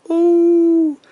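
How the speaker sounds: high, loud startled squeal; startled ooh. Acted. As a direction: let out a shocked high ooh. Witch bumps into Ollie.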